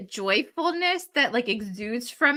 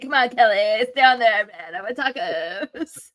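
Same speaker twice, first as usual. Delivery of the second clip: mock whining; 4 dB louder than usual.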